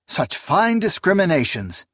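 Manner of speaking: mildly venomous sneer; spat out. A narrator's reading. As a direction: spit the words out with contempt. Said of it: "Akamian spat".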